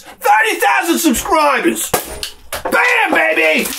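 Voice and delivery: high triumphant shout; excited shout. Real, not acted. Toasting his subscribers, he whoops in triumph. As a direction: cheer it out like a victory. Celebrating thirty thousand subscribers.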